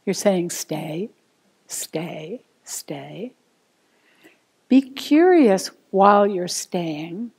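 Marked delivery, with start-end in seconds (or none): 0.5-3.3 low, soft command to a dog, lifting at the end; hushed, patient dog-training voice; dog trainer. Acted. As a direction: gently tell a dog to stay, word by word. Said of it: "like you're training a dog".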